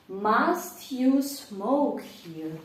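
very slow, every word separate; slow drill. Shown as a demonstration. Teacher drills the question very slowly. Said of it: "утворити питальне речення".

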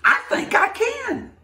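high, hopeful little-engine voice. Acted. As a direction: talk yourself into it, small and brave. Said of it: "She said".

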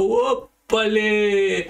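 very slow, high "¡ópale!" with the vowels stretched; drawn-out mock yelp. Ugarte realizing the pole is falling, a slow stretched-out yelp. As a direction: stretch "¡ópale!" out long as the pole keeps going over.

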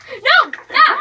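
startling boo. Real, not acted. Scared by friend's boo.

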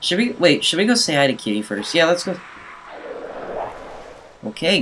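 ordinary talk

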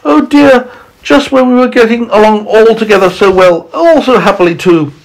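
high, fretful lament; crying out. A narrator's reading. Ladybird mourning her lost companions, crying out. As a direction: wail it in storybook dismay. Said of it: "cried the ladybird".

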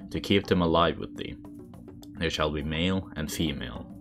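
ordinary delivery

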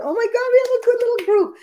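real high-pitched thrill; delighted squeal. Real, not acted. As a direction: squeal with delight at who has turned up. Delighted at live audience turnout.

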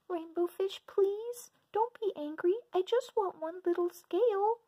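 very high tiny pleading voice; small fish voice. Acted. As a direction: plead in a squeaky little voice. Little fish voice.

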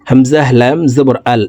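ordinary delivery

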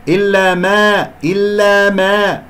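إلا ما drawn out with the a bent towards e; wrongly tilted alif. Shown as a demonstration. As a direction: stretch إلا ما slowly, long vowels thinned to "eh". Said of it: "هذه الألفات مقللة". (نطق إلا ما خطأً بتقليل الألف)

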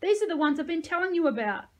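high, loud, thrilled call; shouted. A narrator's reading. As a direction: tell a colleague with excited pride. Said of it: "she shouted to the medic".

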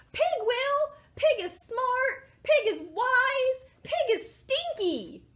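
high, emphatic chant of praise; rousing group cheer. Acted. Animals volunteering pig for the job, high rousing cheer. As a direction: proclaim each phrase high and slow.

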